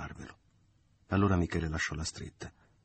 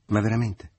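loud, higher hurt surprise; taken aback and wounded; mortified surprise; 5 semitones higher, 9 dB louder than usual. A narrator's reading. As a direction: sorpresa mortificata, forte. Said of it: "rispose la donna mortificata".

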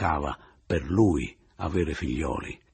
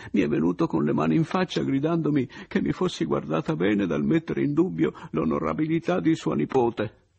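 Oliva sconvolta; 10 semitones higher, 4 dB louder than usual. Acted. Oliva racconta, ancora sconvolta.

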